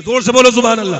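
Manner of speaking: very loud call to the congregation; loud preacher's call for a response; rallying shout. Real, not acted. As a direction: belt it out to rouse the crowd. Preacher rallies crowd to shout praise.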